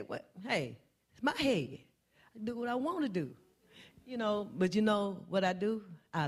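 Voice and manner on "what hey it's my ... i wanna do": a hint of a defensive, huffy "it's my head"; mock indignant comeback; offended retort. Preacher voicing a touchy reply to a wig remark, mildly huffy.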